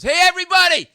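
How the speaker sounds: high, full-voiced shout; yelling at a crowd. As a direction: holler to silence a noisy bar. Man yells at whole bar.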